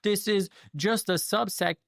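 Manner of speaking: high, slow mock voice of an objector. Mocking critics who say not all, in a high whiny voice. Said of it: "people that are gonna say".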